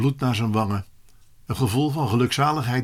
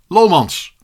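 calling out; 5 semitones higher, 9 dB louder than usual. Acted. Teacher calls out pupil's name.

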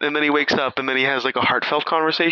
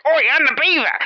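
high, rough Aussie-style beaver shout; the beaver announcing itself to the kid, high and loud; 14 semitones higher, 4 dB louder than usual. Retold or imitated. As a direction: bark it as a brash puppet beaver. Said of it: "and he's like".

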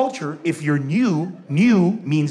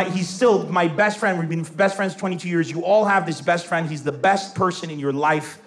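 normal talking voice

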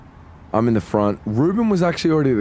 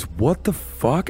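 higher, drawn-out mock alarm; scared tone; 6 semitones higher, much slower than usual. Bandmate spots something on the car camera, mildly frightened. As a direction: imitate frightened disbelief, stretched out slowly. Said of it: "she had this tone in her voice".

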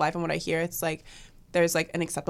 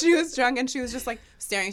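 amused; 6 semitones higher than usual. Real, not acted. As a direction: burst out with it, amused. Friend laughs at drunk memory.

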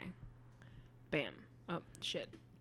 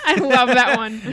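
words through laughter; laughing; 7 dB louder than usual. Real, not acted. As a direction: giggle through it. Laughing while speaking.

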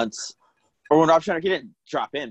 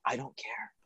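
whisper; 14 dB quieter, slower than usual. Retold or imitated. Retelling telling off a patron.

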